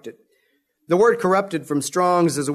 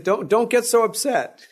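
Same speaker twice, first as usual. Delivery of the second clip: higher-pitched mock appeasing; imitated placating; 7 semitones higher than usual. Aaron soothing an angry Moses, imitated placating. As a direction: play someone trying to calm an upset man, pitched up. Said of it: "You can just see him".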